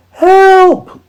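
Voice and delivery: very high, loud yelp; cry for help. A narrator's reading. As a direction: scream it out in panic. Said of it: "cried all the animals".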